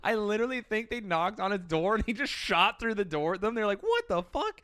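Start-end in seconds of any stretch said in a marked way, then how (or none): 3.8-4.6 a squeaky high yelp of shock, half-joking; mock shocked. Mounties reacting to shots through the door, mock shocked squeak. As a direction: jump way up into a startled falsetto.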